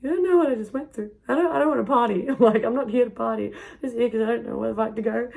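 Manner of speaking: high, rushed retelling of a frantic protest; worked-up, high-pitched mock pleading; exasperated protest. Retelling own overwhelmed protest to clubbers.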